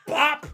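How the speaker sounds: loud mock punch noise; punch sound effect. Showing how the brother punched him, loud mock bop. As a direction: pop it out like a hit.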